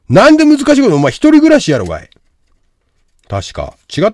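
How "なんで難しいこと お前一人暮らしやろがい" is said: loud, disbelieving scolding; exasperated retort. Real, not acted. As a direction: challenge the excuse at full voice. Retorting to viewer's excuse.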